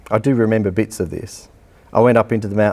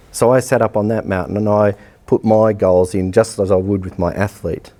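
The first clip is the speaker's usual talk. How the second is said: usual speaking voice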